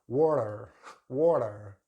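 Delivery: water with r-colored ending; American water with R. Shown as a demonstration. Teacher imitating American water. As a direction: finish water with a clear r. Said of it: "pronounce the letter R at the end".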